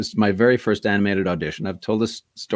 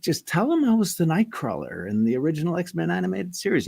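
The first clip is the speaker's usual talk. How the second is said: higher, eager hook for the agent; pleading pitch; 9 semitones higher than usual. Retold or imitated. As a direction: sell yourself a little too hard. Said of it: "And I said".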